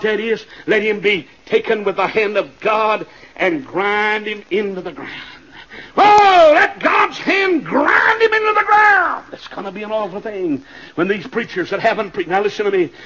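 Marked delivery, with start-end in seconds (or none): 6.0-9.2 high, slow roar of judgment; drawn-out pulpit shout; full-force preaching. Real, not acted. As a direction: roar it out, dragging the words. Repeats the curse at full force.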